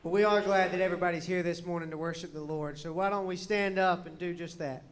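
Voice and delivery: ordinary talk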